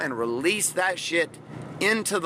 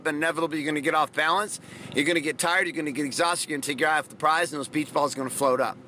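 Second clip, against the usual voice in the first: usual speaking voice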